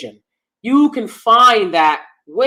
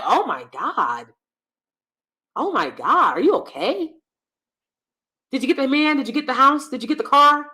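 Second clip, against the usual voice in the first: mock disbelief; 6 semitones higher than usual. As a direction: rhetorically question her, lightly mocking. Mocking reaction to the baby-voice woman.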